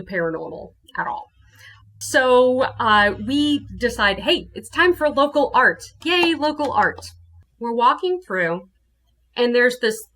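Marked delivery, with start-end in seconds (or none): 4.2-7.1 high, bouncy little hooray; mock cheer. Deciding with a friend to see art, cheery. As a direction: lift it brightly like rallying a friend.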